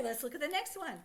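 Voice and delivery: usual speaking voice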